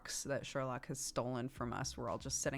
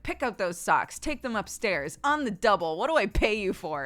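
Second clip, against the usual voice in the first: loud, higher, barking hammed-up orders; mock bossy boss; 6 semitones higher, 11 dB louder than usual. Mom pretending to boss her daughter around, hammed-up loud orders. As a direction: play the demanding boss for laughs. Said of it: "pretend to be my boss".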